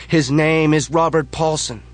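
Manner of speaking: high, loud chanted shout; crowd yell. Acted. Crowd answers the leader, loud chanted yell. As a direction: shout it back like a whole crowd. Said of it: "the crowd yells".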